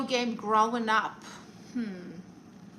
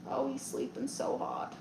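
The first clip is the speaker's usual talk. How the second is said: soft remark, much quieter than usual; hushed aside; 2 semitones higher, 10 dB quieter than usual. Real, not acted. Streamer tells viewers about the sleeping dog, hushed.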